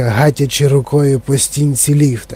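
ordinary delivery

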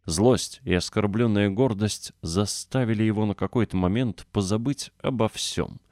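usual speaking voice

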